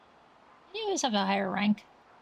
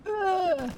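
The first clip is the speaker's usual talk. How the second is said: sudden pretend groan of pain; a mock wounded grunt; 9 semitones higher than usual. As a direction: grunt like you've just been hit. Shot by a sniper in play.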